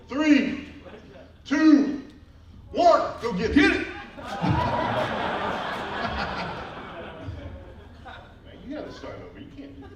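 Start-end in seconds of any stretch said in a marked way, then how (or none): 0.1-3.9 loud, high countdown shout. Real, not acted. Countdown then cheering. 3.9-8.4 open, high laugh; hearty laugh. Real, not acted. Laugh after failed rip.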